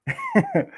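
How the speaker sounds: short high-pitched giggle; a quick squeal of a laugh; high nervous laugh; high squeaky laugh. Real, not acted. Laugh after naming club.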